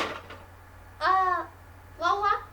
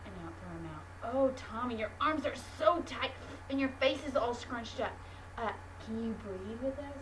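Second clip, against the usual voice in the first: much lower, soft soothing coaching; calm teacher voice; 13 semitones lower, 13 dB quieter than usual. Acted. Teacher calms angry Tommy with a deep breath, soft soothing voice.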